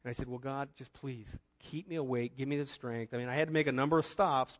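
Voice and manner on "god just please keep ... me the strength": ordinary talk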